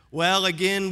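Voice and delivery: usual speaking voice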